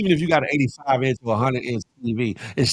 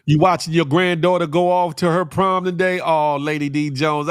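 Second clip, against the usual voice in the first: ordinary talk, nothing marked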